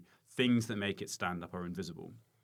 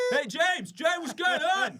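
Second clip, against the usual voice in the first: high, loud calling-out punter; mock heckler; 11 dB louder, slower than usual. Comedian mocking a punter calling a mate, high and loud. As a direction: shout across the tent like a rowdy drop-in. Mocking festival crowd drop-ins.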